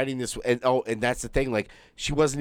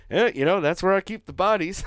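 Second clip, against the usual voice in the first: higher-pitched joking quote, a bit louder; joking show guy; 10 semitones higher, 4 dB louder than usual. Retold or imitated. Host quotes the man joking on the show, mock jokey voice. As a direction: toss it off as a lightly voiced wisecrack. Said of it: "he even joked in the show".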